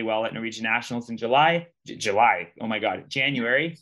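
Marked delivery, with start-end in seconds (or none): none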